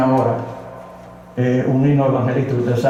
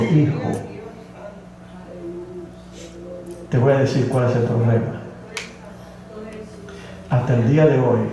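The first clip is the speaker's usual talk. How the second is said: voice of God; 5 dB quieter than usual. Retold or imitated. God answers the weeping man, lightly voiced, soft and gentle. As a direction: speak gently as a calm divine father. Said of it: "la voz de Dios, que me dijo".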